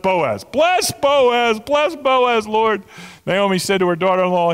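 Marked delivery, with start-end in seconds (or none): 0.4-2.8 high, loud burst of praise; excited and thankful. Acted. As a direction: cry out the blessing with joy. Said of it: "she's excited and thankful".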